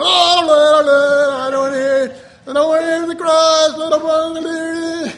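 high, loud sing-song blah blah; mock whiny complaint. Preacher mocking listeners tired of the cross, loud sing-song whine. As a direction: whine it like a sulky kid.

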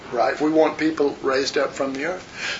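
ordinary talk, nothing marked

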